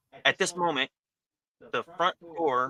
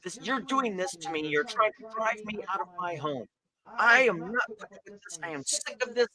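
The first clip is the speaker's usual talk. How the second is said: high-pitched acted tirade at only moderate volume; screaming and yelling; 8 semitones higher than usual. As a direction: rant like an outraged half-orc woman, pitch raised. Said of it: "she starts screaming and yelling".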